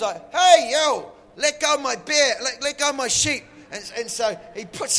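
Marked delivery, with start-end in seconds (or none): 0.3-3.4 loud and high, yelling at a thief; shepherd boy bellowing across the field; angry yell. Acted. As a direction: call out hard to make the bear drop it. David yelling at the bear.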